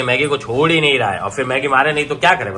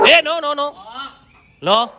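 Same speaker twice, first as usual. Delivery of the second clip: very high, insistent no to the dog; sharp dog command; 14 semitones higher, slower than usual. Real, not acted. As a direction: cut the dog off fast. Scolding dog.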